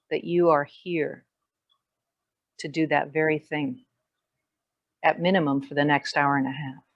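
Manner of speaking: measured meditation voice with long pauses; guided meditation. Real, not acted. Yoga teacher leading the class into grounding and gratitude, guided meditation. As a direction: settle them into presence, phrase by phrase, unhurried.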